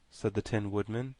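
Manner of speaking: plain reading voice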